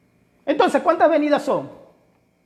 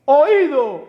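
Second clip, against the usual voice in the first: barked drill order, louder; military command; 3 semitones higher, 6 dB louder than usual. Retold or imitated. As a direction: call it like a sergeant on parade. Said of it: "voz de mando es cuando usted está sirviendo en el ejército". (voz de mando militar, fuerte)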